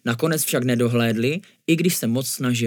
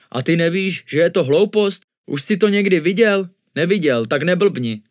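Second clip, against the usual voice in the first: higher, louder scolding comeback; quick retort; 5 semitones higher, 5 dB louder than usual. A narrator's reading. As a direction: put down a silly worry briskly. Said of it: "pohotově odpověděl mnich". (pohotově, káravě)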